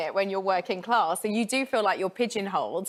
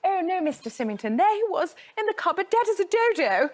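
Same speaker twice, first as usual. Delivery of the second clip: hammed-up received pronunciation, high pitched; posh accent; 9 semitones higher than usual. Shown as a demonstration. Actress demonstrates her Miss Marple lines, exaggerated posh accent. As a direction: put on a plummy, old-film RP accent. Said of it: "Do your posh accent".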